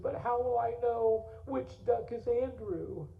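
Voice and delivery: put-on high, slow, tearful little boy's plea; tearful child. Little Bud pleading with his dad about the duck, tearful and high. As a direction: ask it like a small boy close to tears. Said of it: "He started crying".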